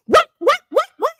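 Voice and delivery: high rapid what; shocked. Retold or imitated. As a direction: fire off high startled whats. Mock shocked reaction.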